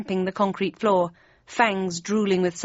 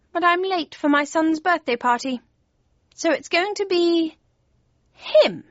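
high-pitched cat voice; Cheshire Cat strategising; 7 semitones higher than usual. A narrator's reading. Cheshire Cat planning the next move, high-voiced. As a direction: muse high, building to the last word.

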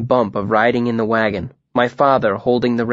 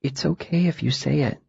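put-on soft coaxing girl; 3 semitones higher, 6 dB quieter than usual. A narrator's reading. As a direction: coax him softly. Ari coaxing softly.

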